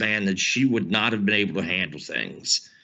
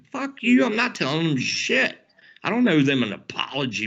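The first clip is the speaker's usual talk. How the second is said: angry retort; 6 semitones higher than usual. Retold or imitated. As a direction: spit it back at him, furious. Angry retort to pushy friend.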